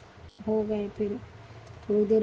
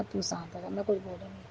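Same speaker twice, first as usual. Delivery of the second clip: hushed calming; 2 semitones lower, 8 dB quieter than usual. Retold or imitated. Mother calming son.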